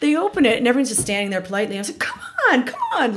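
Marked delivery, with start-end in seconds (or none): 2.0-3.2 very high, laughing urging; calling out impatiently. Retold or imitated. Customer rallying a polite queue to move, mock impatient call. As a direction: playfully holler to hurry people along.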